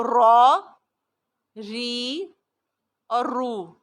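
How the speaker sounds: را ری رو recitation; ra ri ru drill. Shown as a demonstration. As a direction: drill the three vowels on را. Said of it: "اسی طرح جب یہ را متحرک ہے".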